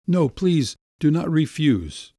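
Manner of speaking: put-on young man pleading with the doctor not to refuse; pleading Sasha. A narrator's reading. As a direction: beg him not to refuse. Sasha begging.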